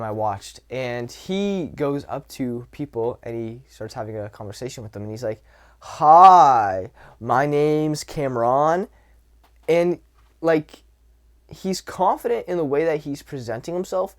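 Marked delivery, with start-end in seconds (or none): 5.8-8.9 loud, drawn-out, odd greeting; socially awkward guy. Retold or imitated. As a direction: imitate an awkward guy introducing himself too loudly. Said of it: "his voice is coming off socially weird".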